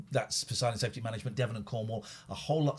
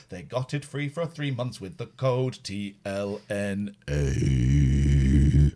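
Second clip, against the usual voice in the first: slow, deliberate ad read; slow radio sponsor announcement; 3 semitones higher, 5 dB louder, much slower than usual. Acted. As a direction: announce each sponsor slowly. Reads the sponsor list.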